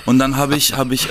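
ordinary delivery

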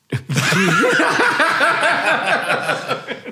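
high shrieking laugh. Real, not acted. Burst of laughter at joke.

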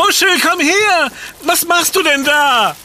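calling out. Acted. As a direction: call the dog back, alarmed. Calling the dog at the hedge.

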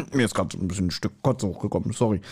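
a hint of queasy Willi; nauseated Willi. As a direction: Willi muttering he nearly threw up. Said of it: "und Willi so".

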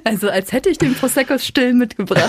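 lachend scherzend. Real, not acted. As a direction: durch das Lachen hindurch sprechen. Joking, laughing. (lachend einen Witz machen)

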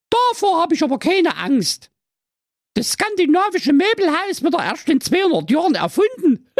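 sehr hohe, spöttische junge Frau; Prinzessin. Acted. Prinzessin spricht. (hohe Prinzessinnenstimme)

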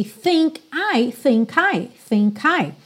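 "think I" run together, K gliding straight into I; linked think I. Shown as a demonstration. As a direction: join the K of "think" onto "I". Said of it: "ligar as consoantes nas vogais". ("think I" ligado, o K colado no I)

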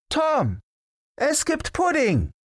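high, sweet luring call; coaxing call. Acted. Frau Dete luring the hidden boy with pudding, sweet high coaxing call. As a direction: tempt him out with a lilting high call. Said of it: "rief Frau Dete verführerisch".

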